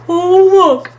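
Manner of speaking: high, loud, stretched exclamation; excited cry. A narrator's reading. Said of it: "cried Mole".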